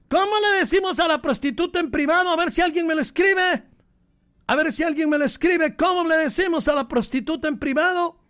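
high, pushing eager radio host; excited challenge. Acted. Preacher daring radio listeners to write in, high and eager. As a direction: dare the audience to answer.

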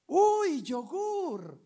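high, loud cry of delight; imitated shout. Lady on seeing the bag of food, high imitated shout. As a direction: yelp it out high, imitating an excited woman. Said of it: "la señora grita".

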